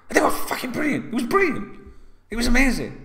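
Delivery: gushing with delight, high; excited. Real, not acted. Thrilled recalling fans at station.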